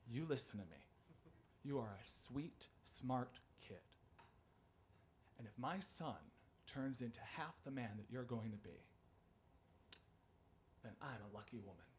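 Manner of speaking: soft, low, sincere comfort; hushed, heartfelt encouragement; tender reassurance. Retold or imitated. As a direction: speak gently with long pauses, as a teacher consoling a child. Teacher comforts the crying pupil.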